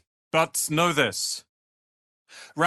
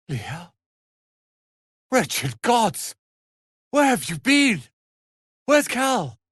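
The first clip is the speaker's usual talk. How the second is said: much higher and louder, desperate questions; alarmed shout; 11 semitones higher, 5 dB louder, slower than usual. Acted. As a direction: shout in alarm for the missing friend. Alarmed reunion, companion missing.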